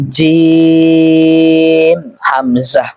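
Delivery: very slow, each letter name stretched; drawn-out letters. Shown as a demonstration. Teacher stretching letter names for students. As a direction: hold every vowel long and even. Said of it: "টেনে টেনে".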